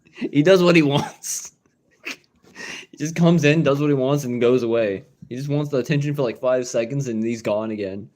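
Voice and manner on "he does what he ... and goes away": chuckling through the words; talking through a laugh. Real, not acted. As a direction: laugh it out fondly. Laughing about the pet.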